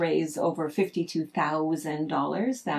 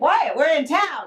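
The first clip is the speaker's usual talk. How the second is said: exasperated mom; 8 semitones higher, 5 dB louder than usual. Retold or imitated. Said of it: "It's like".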